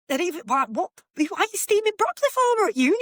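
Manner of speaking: high-pitched, incredulous rant; incredulous and rising, stumbling into a squeal of questions; baffled outburst. Retold or imitated. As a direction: retell your disbelief, voice shooting up. Her baffled reaction to the flatmate.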